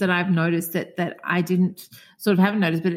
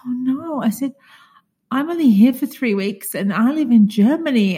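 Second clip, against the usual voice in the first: raised, pleading protest; retold dismay; 6 semitones higher, 6 dB louder than usual. Customer pleading with the newsagent over the last paper, mock-devastated. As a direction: replay a dismayed plea, pitched up and emphatic. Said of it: "I was so devastated".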